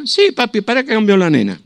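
a hint of an eager old mother; mother's voice.

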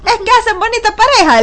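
aunt teasing the pair, high and playful. Retold or imitated. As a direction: tease the couple sweetly. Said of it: "le decía".

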